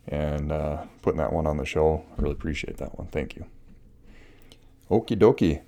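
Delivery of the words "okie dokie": bright, bouncy "Okie dokie" with a falling end; playful okie dokie. Shown as a demonstration. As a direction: toss off "Okie dokie" cheerfully. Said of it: "okie dokie is different than so".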